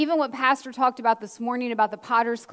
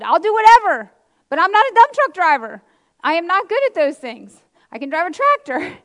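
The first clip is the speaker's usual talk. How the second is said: higher, much louder mock protest; retold protest; 5 semitones higher, 9 dB louder than usual. Telling father-in-law she's no truck driver, loud protest. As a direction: insist loudly, playing up the objection.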